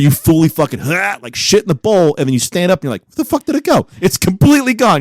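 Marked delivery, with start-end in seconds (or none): 3.0-5.0 high-pitched, incredulous mock panic; baffled outcry. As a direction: act out utter bewilderment, pitched way up. Acting out baffled toilet discovery.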